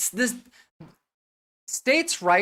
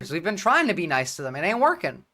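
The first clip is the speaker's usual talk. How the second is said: normal talking voice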